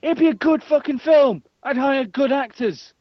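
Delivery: heated insistence. Real, not acted. Defending their dream film to a doubting friend, heated. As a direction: argue it at the top of your voice.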